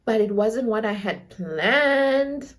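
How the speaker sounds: slow, drawn-out mock-earnest plea; imitated pleading boyfriend. Boyfriend assuring her mom nothing happened, slow mock-earnest plea. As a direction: stretch out each phrase in feigned sincerity. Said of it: "then he was like".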